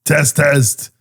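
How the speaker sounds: put-on Schwarzenegger accent. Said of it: "you do one".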